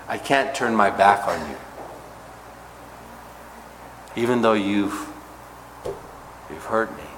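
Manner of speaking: soft, tender voice of God. Pretend. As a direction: let it fall soft and tender. Voicing God's tender love to Israel.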